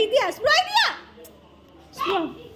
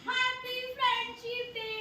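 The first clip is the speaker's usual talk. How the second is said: shy soft greeting; 7 semitones higher, 11 dB quieter, slower than usual. Real, not acted. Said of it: "बोलो, happy friendship day बोलो".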